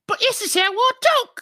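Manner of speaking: high, loud British squawk; exaggerated British woman voice; mock British accent. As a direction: protest in hammed-up British falsetto.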